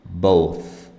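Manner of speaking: "Both" loud, with a strong, drawn-out th; exaggerated th practice. Shown as a demonstration. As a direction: push the th at the end of "Both" hard.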